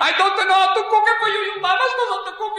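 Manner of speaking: high, hammed-up wailing wife; mock a wife in hysterics, Italian accent; comic high-pitched tearful complaint; hysterical crying. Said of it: "never hear such hysterical crying".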